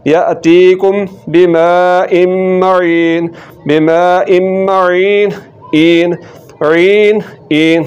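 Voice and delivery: मईन said slowly, ain from the throat; मईन, ऐन हलक से; slow recitation with ain. Shown as a demonstration. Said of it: "आइन के बाद निकालनी है".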